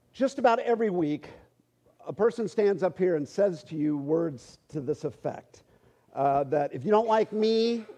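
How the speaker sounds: ordinary talk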